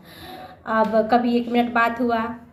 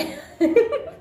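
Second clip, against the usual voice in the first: bashful laugh; 11 semitones higher than usual. Real, not acted. Laughs after retelling his confession.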